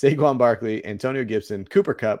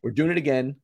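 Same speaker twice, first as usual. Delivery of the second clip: ordinary talk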